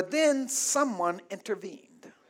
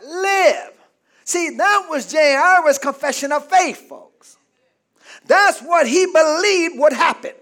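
loud, raised pulpit voice building to shouts; higher, louder revival preaching; full-force preaching; 5 semitones higher, 5 dB louder than usual. Acted. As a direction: preach it hard to the crowd.

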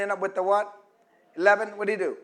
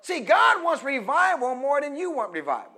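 ordinary talk

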